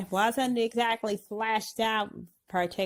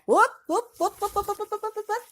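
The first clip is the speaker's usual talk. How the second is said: high, looping whoop whoop like an alarm; cartoon siren chant; mock siren whoops; 11 semitones higher, much faster than usual. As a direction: sound the alarm playfully. Siren noise for bad elves.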